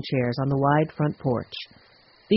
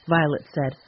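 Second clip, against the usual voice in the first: plain reading voice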